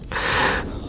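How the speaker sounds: quiet, breathy chuckle; teasing chuckle. Acted. Emperor amused by his wife's angry look, teasing chuckle. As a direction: chuckle at harmless anger.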